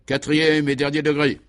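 loud, high, stern announcement; hardened order. A narrator's reading. Commissioner orders the last degree of interrogation, voice hardened. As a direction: give the final order with a hard edge. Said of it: "d'une voix subitement durcie".

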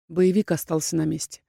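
ordinary delivery, nothing marked

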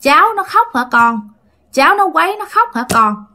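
hint of a ghostly old man; ghostly grandfather. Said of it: "nghe cái người đó hỏi".

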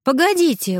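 high, urgent interruption; urgent, voice leaping up; exclaiming. A narrator's reading. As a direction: cut in with a sudden cry. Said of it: "воскликнула Элиза".

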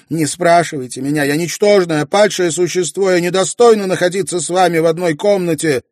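high, loud anguished self-reproach; weeping despair. A narrator's reading. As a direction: cry out in shame. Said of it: "закрыла лицо руками и заплакала". (плача, высоко и громко, в отчаянии)

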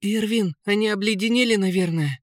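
high-pitched prince's voice; worried prince. A narrator's reading. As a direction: suggest it anxiously, pitched high. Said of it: "предположил принц". (высокий встревоженный голос принца)

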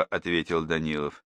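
plain reading voice

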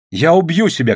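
high-pitched desperate cry. A narrator's reading. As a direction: shout a desperate threat. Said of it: "крикнула Катя".

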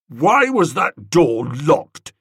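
slow, loud demand. Acted. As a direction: lean on every word, suspicious. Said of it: "demanded Tuppy".

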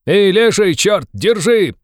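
loud, high shout after a fugitive. A narrator's reading. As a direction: yell for someone to grab him. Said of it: "с криком вырвался кто-то". (громкий высокий крик)

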